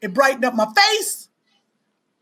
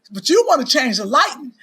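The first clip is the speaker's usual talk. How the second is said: ordinary delivery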